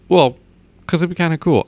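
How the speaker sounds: ordinary talk, nothing marked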